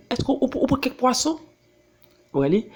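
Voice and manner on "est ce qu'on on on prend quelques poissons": mother's curious high question; imitating mother. A mother asks her son about the fishing trip, in a raised, curious voice. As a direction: ask it like mom checking on the catch. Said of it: "maman dit".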